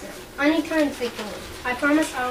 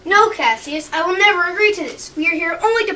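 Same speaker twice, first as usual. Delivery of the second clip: firm refusal; 3 semitones higher, 6 dB louder than usual. Acted. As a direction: raise the voice, flatly refusing. Brutus refuses Cassius heatedly.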